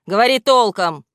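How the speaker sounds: loud, sharp demand; irritated order. Acted. Грамотейка demands a clear answer, irritated. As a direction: get to the point, impatient.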